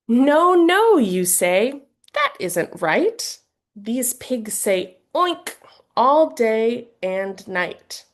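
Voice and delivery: slow, playful storybook reading; lively picture-book voice, drawn out; reading to children. Shown as a demonstration. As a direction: read it like it's bedtime with a toddler. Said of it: "an example of how you might read this book".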